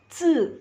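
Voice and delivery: "C" as ts, tongue behind teeth, no puff; tongue at the teeth, hold air back on "C"; dental Z. Shown as a demonstration. Teacher giving the Z example, unaspirated. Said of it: "Kedengeran bunyinya beda".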